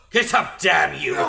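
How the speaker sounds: angry bark; angry shout. Acted. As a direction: order the fool up. Scolding fallen man.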